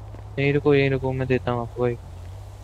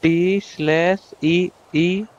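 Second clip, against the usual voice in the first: letter by letter, drawn out, higher; slow dictation; 4 semitones higher, 3 dB louder, much slower than usual. Real, not acted. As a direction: dictate slowly, stretching each key. Dictating a typed command.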